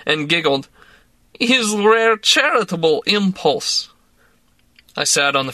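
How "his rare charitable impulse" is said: slow, higher sardonic Russian woman; dry jab in a Russian accent. A narrator's reading. Drunk woman mocking her boss, in a Russian accent. Larissa voice.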